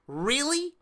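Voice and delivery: high sarcastic disbelieving really; incredulous. Real, not acted. Fan mocking WWE's title match choice, sarcastic disbelief. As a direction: scoff at it, sky-high.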